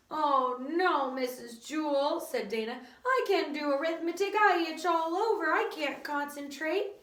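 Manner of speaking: 1 Dana whining. A narrator's reading. Dana complaining to teacher.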